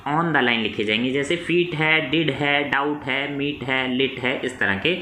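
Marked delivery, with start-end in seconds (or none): none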